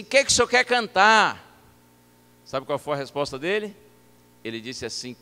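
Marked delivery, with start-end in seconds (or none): 0.0-1.4 loud, raised question; pergunta em voz alta. Retold or imitated. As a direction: project it to a deaf old man. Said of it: "Eu não vou amarelar agora". (perguntando alto, sem amarelar)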